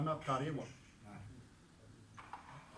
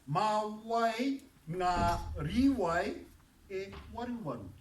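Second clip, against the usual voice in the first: drawn-out, louder model sentence, word by word; very slow demo; 4 semitones higher, 4 dB louder, slower than usual. Shown as a demonstration. Teacher asks class to listen, very slow and louder. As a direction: stretch every word so they can follow. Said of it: "listen to this".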